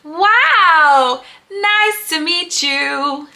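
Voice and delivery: normal talking voice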